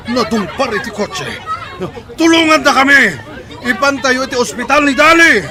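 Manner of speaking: high, loud desperate pleading; panicked cries for help. Acted. Father pleads with bystanders to rush his wife to hospital. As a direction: beg the crowd for help in terror.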